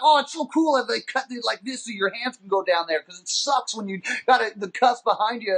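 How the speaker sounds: high-pitched chatty gushing; hammed-up naive admiration; mock enthusiasm. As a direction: gush as if thrilled by it. Retold chatter in police car.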